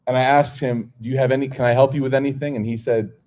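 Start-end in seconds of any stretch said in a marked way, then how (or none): none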